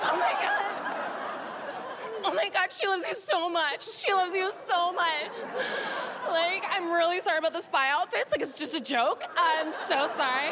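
high, hammed-up tearful gushing; acted bursting into tears. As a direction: blubber an overwhelmed apology, played for laughs. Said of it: "I burst into tears".

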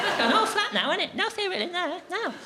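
high, sing-song coo over the box; a hint of baby talk. Cooing over the flattened box.

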